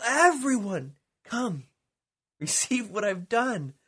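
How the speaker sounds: high, loud calling invitation; the King shouting his invitation to all creation; preaching at full force. Acted. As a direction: call everyone out of slavery with fire.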